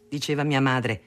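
ordinary delivery

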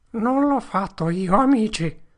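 high, loud pleading with a sob; sobbing. Acted. Potter pleading innocence to the crowd, sobbing high and loud. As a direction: beg through tears. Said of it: "singhiozzò".

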